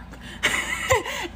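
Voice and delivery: high breathy laugh, half crying; laughing whisper. Real, not acted. Storyteller laughing at her failed love spell. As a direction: lose it after the punchline.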